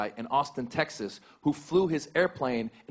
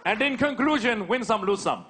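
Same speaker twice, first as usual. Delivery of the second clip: mock formal statement; 5 semitones higher than usual. Mock official terrorist statement.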